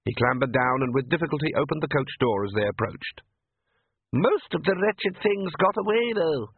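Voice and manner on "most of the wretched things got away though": high, slow lady's voice; mother. A narrator's reading. Mother complains to father, high and slow. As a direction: lightly complain like a grand mother. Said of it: "said Mother".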